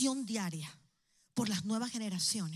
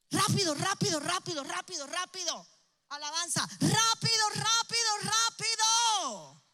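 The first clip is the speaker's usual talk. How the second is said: urgent call; 7 semitones higher than usual. Real, not acted. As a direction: rush them to the front. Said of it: "corran y pasen al frente".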